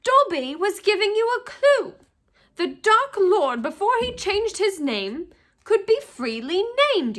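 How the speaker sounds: high elf voice, eager to explain; a little servant pointing out the obvious; earnest house-elf. A narrator's reading. House-elf telling Harry the name was a clue, high and eager.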